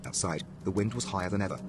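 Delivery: plain reading voice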